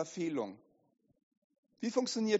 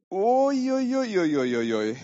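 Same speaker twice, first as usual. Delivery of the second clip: loud wailing uiuiui, sliding downward; long exaggerated oh-dear cry; mock-alarmed "uiuiui"; 8 dB louder than usual. Inner voice dreading exposure, loud mock alarm.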